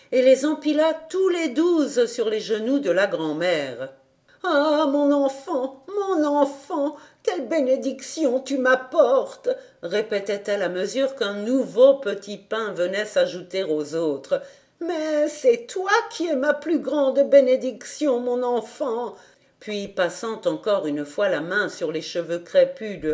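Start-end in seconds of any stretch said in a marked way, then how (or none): 4.5-9.5 put-on old woman overcome with gratitude; moved grandmother. 14.8-19.1 put-on old woman overcome with gratitude; moved grandmother. Grandmother tender.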